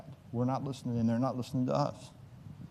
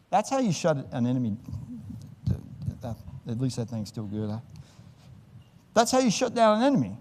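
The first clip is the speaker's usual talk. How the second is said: usual speaking voice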